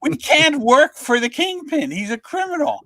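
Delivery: high, lightly voiced protest; a touch of outraged staff; indignant reporters. Reporters object to their new criminal owner, mildly outraged. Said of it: "half the reporters are going".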